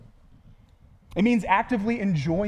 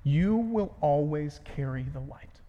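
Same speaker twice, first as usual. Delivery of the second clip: slow; 4 semitones lower, slower than usual. Shown as a demonstration. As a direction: let the promise sink in slowly. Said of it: "Here's how we can summarize it".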